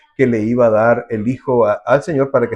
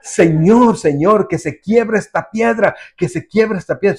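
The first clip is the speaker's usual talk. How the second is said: fervent pleading prayer; 6 semitones higher than usual. Retold or imitated. Preacher mimics a believer crying out for the rock to break, fervent plea. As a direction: beg God hard to break the stone. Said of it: "Es decir".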